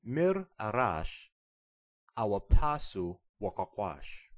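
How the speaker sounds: Mir arash broken apart, then awapasu wakakwash drawn out slowly; teacher slowing Mir arash, awapasu wakakwash down for the class; slow model for repeating. Shown as a demonstration. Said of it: "Listen and repeat".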